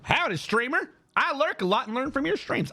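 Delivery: high, folksy Southern accent read. Shown as a demonstration. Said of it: "I don't know why I went Southern for you".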